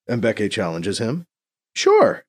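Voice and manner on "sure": high, loud burst of enthusiasm; enthusiastic. Acted. BC reassuring a doubtful Mbeke, enthusiastic. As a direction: jump on it eagerly, pitch leaping up. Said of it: "says with enthusiasm".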